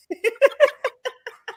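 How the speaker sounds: high bubbling laugh. Real, not acted. Guest joking about her Southern lingo, high laugh.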